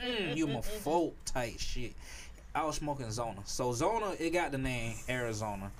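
normal talking voice

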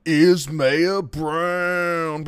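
drawn-out, high, loud name announcement; hype announcer. Pretend. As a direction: belt out the name like a ring announcer. Introduces co-host like ring announcer.

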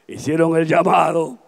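ordinary delivery

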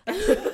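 loud burst of laughter. Real, not acted. Laughs at own joke.

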